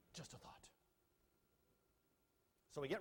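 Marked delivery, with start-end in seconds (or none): 0.1-0.7 whispered aside. Real, not acted. Dry aside to audience.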